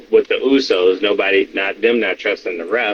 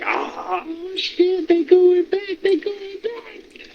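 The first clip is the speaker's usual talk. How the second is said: high squeal of delight; mock excited fan; 4 dB quieter, much slower than usual. Co-host mimics fans hearing the original theme, high and delighted.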